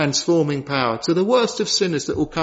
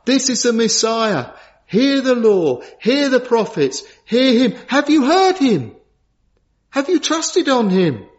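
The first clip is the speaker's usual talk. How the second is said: emphatic, climbing high as it goes; preached divine proclamation; 7 semitones higher, 3 dB louder than usual. Acted. Preacher voicing God's witness to the congregation, building fervently. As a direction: proclaim it, then press it on the hearers. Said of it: "God was witnessing, saying".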